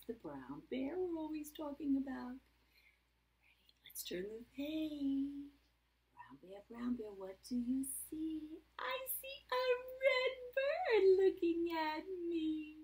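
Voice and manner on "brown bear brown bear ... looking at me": sing-song picture-book reading, rising higher on the answer; reading to children. A narrator's reading. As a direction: read it playfully to small children. Reads picture book aloud.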